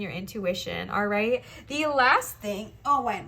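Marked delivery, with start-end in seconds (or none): 1.7-3.2 slow, strained words while bending over. Real, not acted. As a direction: talk while bent down, straining. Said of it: "why do I talk while I bend".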